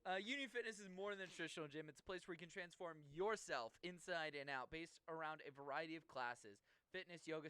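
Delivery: smooth sponsor read. Acted. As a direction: read it like a radio ad.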